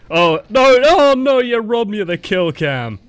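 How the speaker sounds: loud, high-pitched wail of protest; loud drawn-out lament, falling at the end; dismayed outcry. Real, not acted. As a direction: cry out at being robbed, then ease down. Robbed of the kill cam.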